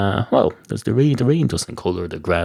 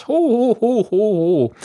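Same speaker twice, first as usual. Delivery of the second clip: hammed-up jolly laugh; 13 semitones higher, 3 dB louder than usual. Salesman laughing at his flat-pint pun, hammed-up ho-ho-ho. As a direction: ham up a jolly belly laugh at your own joke.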